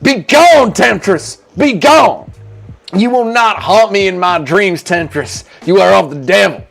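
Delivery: high, loud, theatrical banishing of a temptress; Bryce rejecting a temptress. Acted. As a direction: cast her out like a hammed-up righteous zealot. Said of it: "Do an impression of reje-, Bryce rejecting Nina Drama".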